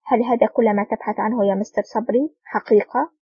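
ordinary delivery

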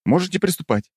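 high character voice. Acted. Character voice in audiobook.

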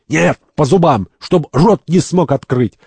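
high, forceful correction; heated urging. Acted. Third urges a blow to the teeth, heated. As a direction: push for a harder blow.

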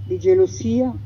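usual speaking voice

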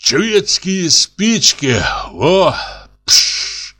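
high, drawn-out show-off voice; slow, high-pitched bragging; boastful show-off. A narrator's reading. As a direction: show off your matches, ending in a fizzing "psh". Boasting about matches, imitating their strike.

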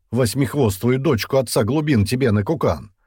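angry curse. A narrator's reading. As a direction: curse him out with feeling. Ostakh curses at Dian. (сердитая брань на повышенном тоне)

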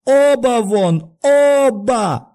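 very high, long-drawn furious shouts; frenzied shout. A narrator's reading. Doctor ordering both visitors out, frenzied shout. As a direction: shriek at them beside yourself. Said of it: "крикнул он не своим голосом".

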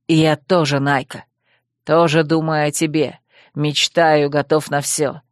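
низкий, вибрирующий голос оборотня-парня, обиженный; сыграй мрачного Вервульфа с упрёком; оборотень Койл. Acted. Койл признаётся Найке. (низкий вибрирующий голос оборотня с упрёком)

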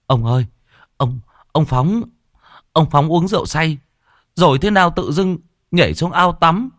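boy bursting in crying to old Trình with bad news; whimpering panic. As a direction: sob out the bad news in a rush. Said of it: "mếu máo".

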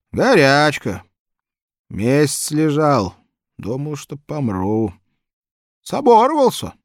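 put-on high, loud chatty old man. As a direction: ramble like a cheerful old man.

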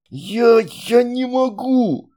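high, slow hoarse straining; hoarse wheeze. A narrator's reading. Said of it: "прохрипел Вератор".

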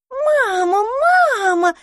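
put-on high squeaky baby-mermaid voices; squeaking. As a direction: squeak it like tiny hatchlings. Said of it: "запищали русалки".

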